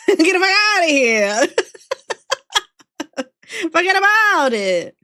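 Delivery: put-on loud, drawn-out Sopranos-style New York accent; big hammed-up New York wiseguy accent; mock New York accent. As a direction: belt it out like a New York mobster. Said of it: "channeling that accent".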